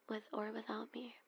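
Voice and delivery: usual speaking voice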